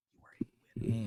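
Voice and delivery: soft breathy whispered encouragement; whispered sincere praise. Real, not acted. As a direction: whisper it like a secret. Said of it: "repeated as whisper".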